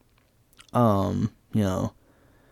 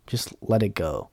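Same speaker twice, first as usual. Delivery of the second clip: ordinary talk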